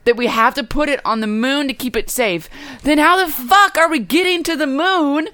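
high, incredulous, building rant; laugh-tinged exasperation; exasperated rant. Real, not acted. Exasperated rant about moon vault.

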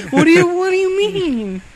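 mock wail. Teased friend protesting, high mock wail.

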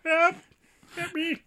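mock cry for help. Mock victim crying for help.

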